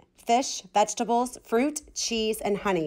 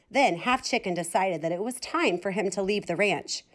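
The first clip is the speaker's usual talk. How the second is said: usual speaking voice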